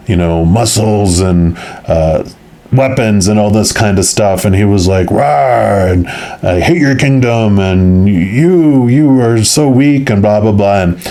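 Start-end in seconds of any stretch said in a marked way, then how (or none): 5.1-10.1 lightly voiced roaring giant, higher pitch; hammed-up giant hurling accusations; mock giant's accusations. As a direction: playfully imitate an angry giant. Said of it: "all these accusations".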